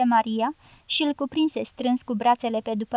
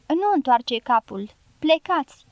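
ordinary delivery

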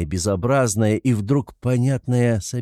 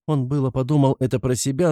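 plain reading voice

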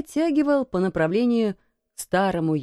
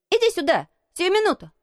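put-on high, impatient boy's command; boy's high, imperious call; shouted order; 11 semitones higher, faster than usual. A narrator's reading. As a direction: order it urgently in a raised voice. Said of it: "крикнул он Дикону".

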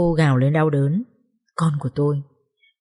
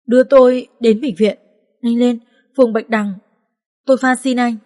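higher, louder panicked plea; urgent pleading; 4 semitones higher, 5 dB louder than usual. Acted. Bleeding woman urging the officer to the hospital, panicked. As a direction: beg him to rush her. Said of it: "bấu chặt rồi nói".

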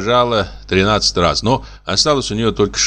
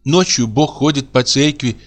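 a girl lightly voicing her mother, higher; sing-song motherly storytelling, a little higher; imitating her mother; 7 semitones higher than usual. A narrator's reading. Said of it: "подражая своей матери".

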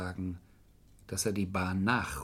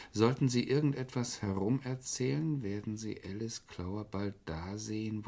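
ordinary delivery

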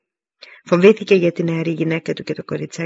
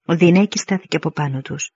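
usual speaking voice

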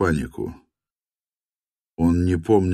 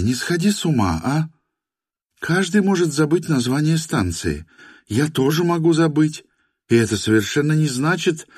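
higher woman's voice, coaxing; calm him in her higher voice; young woman voice; 10 semitones higher than usual. A narrator's reading. (женский голос, уговаривая)

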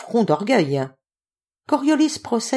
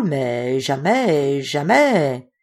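slow, drawn-out amazed repeat of jamais; 3 semitones lower, much slower than usual. A narrator's reading. Stranger marvelling at the ape, amazed and slow.

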